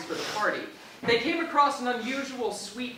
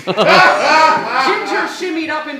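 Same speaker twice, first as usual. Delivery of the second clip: laughing; 6 semitones higher, 12 dB louder, much slower than usual. Real, not acted. Laughing through the smut reading.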